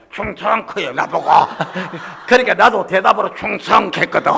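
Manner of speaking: ordinary talk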